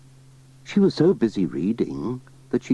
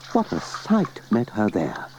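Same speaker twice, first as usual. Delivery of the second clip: ordinary delivery, nothing marked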